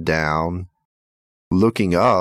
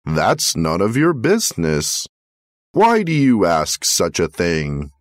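plain reading voice

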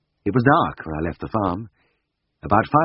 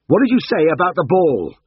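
louder, much higher urgent shout; 10 semitones higher, 6 dB louder than usual. Acted. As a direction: bark the question at the stunned father. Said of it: "shouted at him".

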